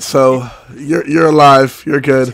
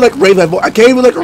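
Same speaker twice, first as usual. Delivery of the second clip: usual speaking voice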